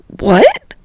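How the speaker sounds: high startled squeak; startled, voice jumping up; flustered boy choking on the idea; choked surprise. Acted. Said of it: "made a choking noise".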